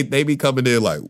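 ordinary talk

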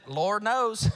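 loud backwoods South Carolina drawl; thick country Southern accent. Acted. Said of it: "maybe he would have said it like this".

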